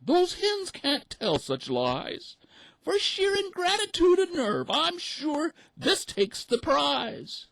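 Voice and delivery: very high, loud, slow duck voice; squeaky mother duck outraged; indignant mother duck. A narrator's reading. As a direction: rage at the hens' nerve. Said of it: "the mother cried".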